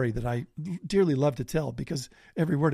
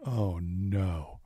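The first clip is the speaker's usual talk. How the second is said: dread; 6 semitones lower than usual. Retold or imitated. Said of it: "And I'm like".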